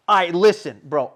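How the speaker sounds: a touch of a stern father taking over; dad mode. Retold or imitated. Telling the 911 operator to listen, firm dad voice. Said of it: "dad mode on them".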